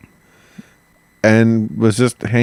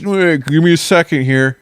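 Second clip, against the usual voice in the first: much higher, louder plea; high exasperated plea; 8 semitones higher, 4 dB louder than usual. Retold or imitated. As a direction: beg for one more second. Acted quote, castaway asks for time.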